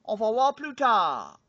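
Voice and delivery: hammed-up French accent, slow and loud; a lightly faked French accent farewell; mock French accent. Vlogger signs off to viewers in a mock French accent. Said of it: "as they say inside Cameron Crowe".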